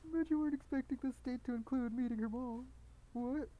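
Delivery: high, mock prankster voice; high-pitched hammed-up gotcha; prank video host. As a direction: burst in like a cheeky prankster. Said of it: "burst in like they're in a prank video".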